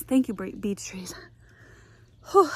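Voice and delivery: panting, half-whispered thanks "Thank you, bre-, beech trees"; out of breath. Real, not acted. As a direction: gasp out "beech trees" after the climb. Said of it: "Sorry, I'm out of breath".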